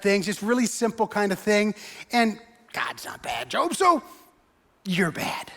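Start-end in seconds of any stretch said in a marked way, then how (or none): 2.1-5.5 hushed verdict. Retold or imitated. Friend mocking Job, hushed whispered blame. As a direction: drop to a near whisper on you're bad. Mock accusing friend's theology.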